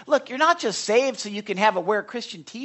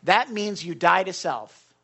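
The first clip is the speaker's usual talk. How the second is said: ordinary talk, nothing marked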